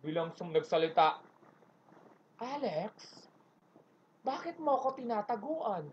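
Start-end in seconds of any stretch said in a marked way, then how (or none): 2.4-5.9 soft, slow hurt boss voice; lightly voiced boss, gently scolding; Ma'am Charo on phone. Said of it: "Si Ma'am Charo". (mahinahong boss sa telepono)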